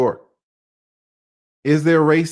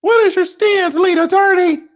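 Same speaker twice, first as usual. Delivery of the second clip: much higher, louder put-on questioning voice; mock interviewer; 9 dB louder than usual. Vlogger voicing an imagined viewer questioning him, high and loud. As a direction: play a pushy interviewer asking his stance. Someone asking his stance.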